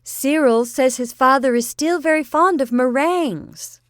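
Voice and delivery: high, loud, spelled-out repeat; aunt repeating loudly for deaf grandfather. A narrator's reading. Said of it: "Josephine repeated".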